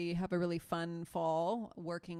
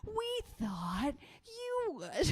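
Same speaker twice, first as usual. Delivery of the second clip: high, slow exaggerated voices trading lines; hammed-up back and forth; 12 semitones higher, 9 dB louder, much slower than usual. Retelling the movie exchange, high drawn-out mock voices. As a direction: stretch each line out comically.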